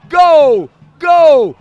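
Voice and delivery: screaming gol, high and very loud; explosive goal commentary. Real, not acted. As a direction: roar the goal, stretching the name. Second team scores, narrator screams.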